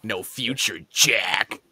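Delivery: lightly voiced low Joker line; a hint of a low sneering Joker; mock Nicholson Joker. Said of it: "the way he's talking like that".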